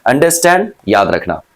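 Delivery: usual speaking voice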